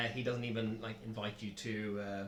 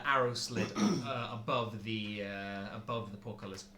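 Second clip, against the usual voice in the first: normal talking voice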